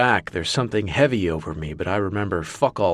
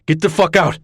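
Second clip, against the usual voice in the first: louder, higher full-force roar; furious bellow; 7 semitones higher, 6 dB louder than usual. Acted. Marcus yelling again, even louder. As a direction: blast it at them at full force.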